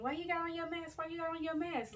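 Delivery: high, mocking the question; mocking nosy people. People asking about her mask.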